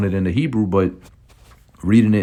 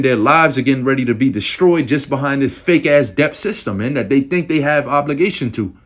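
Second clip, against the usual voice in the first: raised, heated sermon voice; fiery preaching; 3 semitones higher than usual. Real, not acted. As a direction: preach against them with zeal. Fiery preaching against the devils.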